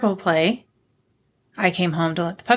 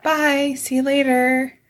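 imitated breezy goodbye; 6 semitones higher than usual. Retold breezy goodbye of husband.

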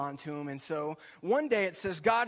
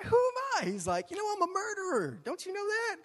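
much higher, pleading Moses voice; protesting Moses. Acted. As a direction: squeak out his excuses like a cornered Moses. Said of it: "Just like Moses, right?".

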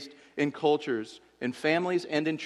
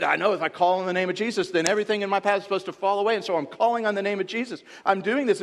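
fast, raised, insistent voice of a struggling believer; imitating a pleading believer; 6 semitones higher, 5 dB louder, faster than usual. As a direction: rattle it off, louder and higher, like someone desperately claiming a promise. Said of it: "You've said".

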